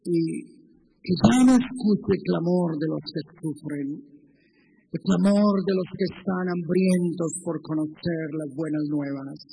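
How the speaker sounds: fervent pulpit exhortation; full-force preaching. Acted. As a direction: drive the altar call home.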